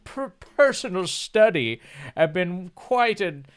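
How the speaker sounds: light mock of a pompous rich homeowner; a hint of a haughty aristocrat; rich snob. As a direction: play a stuck-up rich man showing off his study. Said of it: "rich bastards ... they're like".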